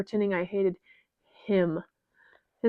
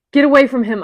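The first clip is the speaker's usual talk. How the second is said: loud, higher angry shout; furious shout at the figures; 5 semitones higher, 12 dB louder, faster than usual. Acted. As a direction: yell at them to back off. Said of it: "I shout".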